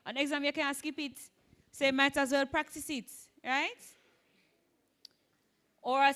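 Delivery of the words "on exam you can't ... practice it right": usual speaking voice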